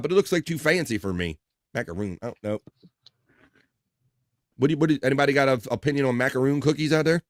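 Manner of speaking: ordinary delivery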